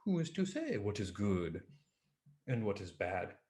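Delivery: soft, unhurried, calm reply; calmly. Acted. Old farmer calmly answers the cheering villagers, soft and unhurried. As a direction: say it gently, unmoved. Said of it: "The farmer says, calmly".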